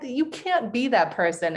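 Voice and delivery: slow, incredulous "no way" voice; lightly voiced disbelief from people who know her now; mock disbelief. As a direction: play people who can't believe it. People doubting her past self.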